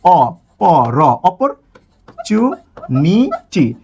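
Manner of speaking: অপরচুনিটি broken into অ, প, র, চু, নি, টি with pauses; teacher sounding out opportunity; syllable by syllable. Shown as a demonstration. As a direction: split the word into its sounds one by one. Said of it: "কিভাবে তৈরি হয়".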